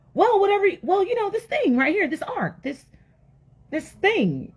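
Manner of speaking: high-pitched, fumbling explanation; flustered Noah. Acted. Noah explaining the ark to puzzled neighbors, flustered.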